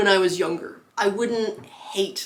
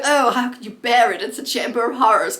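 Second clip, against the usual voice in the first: higher, louder mock English accent; hammed-up English accent, pitched up and louder; 4 semitones higher, 6 dB louder than usual. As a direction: put on an exaggerated English accent. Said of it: "she doesn't have an English accent".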